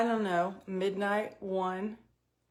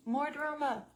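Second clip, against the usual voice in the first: "More drama" hammed up, "More" stretched high and theatrical; mock drama announcement; 7 semitones higher than usual. Shown as a demonstration. Telling the camera her morning got dramatic, hammed up. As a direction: ham up "More drama" like a soap opera teaser.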